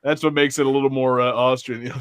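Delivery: normal talking voice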